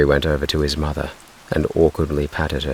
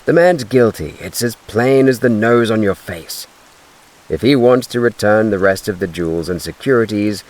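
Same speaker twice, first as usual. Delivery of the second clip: stern insistent man; 5 semitones higher than usual. Acted. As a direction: lay down the law. Applegate insisting on guilt.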